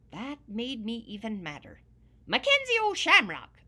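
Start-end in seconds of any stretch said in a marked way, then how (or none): 2.3-3.6 much higher, much louder angry yell; yelled name. Acted. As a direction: bellow the name in a fury. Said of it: "I yelled".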